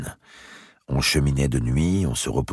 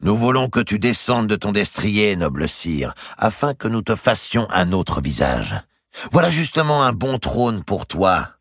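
a hint of a higher, mocking captor; lightly voiced taunting knight; 7 semitones higher, 3 dB louder than usual. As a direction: taunt the king with false courtesy. Narrator voices Gournay taunting.

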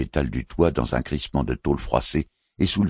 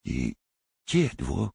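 softer, labored speech through a hurt tongue; injured tongue; 3 dB quieter than usual. A narrator's reading. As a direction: struggle to form words, tongue wounded. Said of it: "Sa blessure à la langue ... rendait son élocution difficile".

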